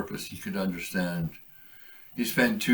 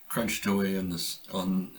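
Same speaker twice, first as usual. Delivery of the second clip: ordinary delivery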